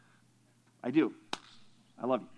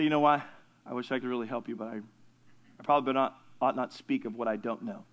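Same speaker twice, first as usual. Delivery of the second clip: humble quiet admission; 8 semitones lower, 6 dB quieter than usual. Pretend. Humble reply to someone seeking advice.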